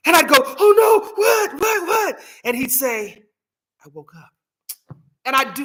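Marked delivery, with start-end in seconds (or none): 0.4-2.2 very high, loud panicked cries; startled. Retold or imitated. Daughter reacting to dad's morning fright, startled and shouting. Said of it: "it startles me and I get all worked up". 3.8-4.3 very soft, understated deadpan; straight-faced serious. Retold or imitated. Dad delivering his morning joke to daughter, soft and straight-faced. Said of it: "just straight face, serious".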